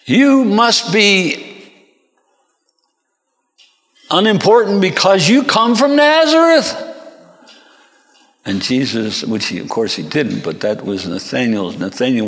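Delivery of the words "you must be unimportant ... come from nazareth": attack with raised voice, words stretched; scornful accusation at high pitch. Acted. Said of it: "When he's being attacked".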